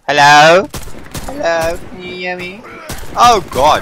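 high, loud calls for help; calling out loud. Real, not acted. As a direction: shout hello across the map.

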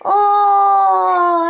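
long, high "oh"; drawn-out high exclamation; excited cry. Real, not acted. Reacts to score in game.

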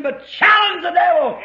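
loud, high battle cry from the pulpit; preaching shout. Real, not acted. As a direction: hurl it out at full force.

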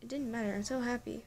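plain reading voice

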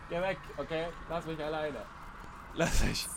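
soft, small pleading voice; timid plea. Pretend. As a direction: beg quietly to be left alone. Pleads with pursuer to leave.

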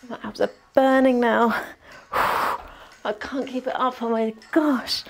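panting between words during sit-ups; gasping, breathless from the workout; out of breath. Real, not acted. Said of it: "Try and get my breath back".

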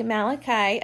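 ordinary talk, nothing marked

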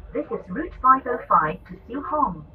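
usual speaking voice

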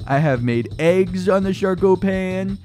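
mock TV pitchman. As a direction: sell it like a hammed-up commercial. Mock advert for invented kitchenware.

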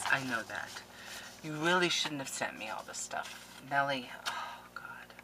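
soft hushed whisper; emotional whisper. Real, not acted. Crafter whispers thanks to Nellie, overwhelmed. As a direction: whisper it, overcome.

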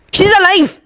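high-pitched, heated retort; biting retort. A narrator's reading. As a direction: bite back at the taunt. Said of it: "Dean bit back".

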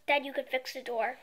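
usual speaking voice

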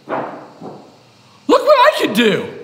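slow, high, drawn-out show-off voice; goofy show-off. Acted. President showing off in a silly promo, slow and high. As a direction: stretch it out like a proud little kid. Goofy bumper take, mock kid.

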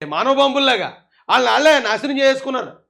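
normal talking voice

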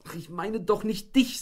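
wife's voice. A narrator's reading. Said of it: "sagte Frauchen".

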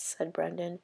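plain reading voice

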